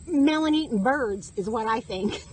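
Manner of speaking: normal talking voice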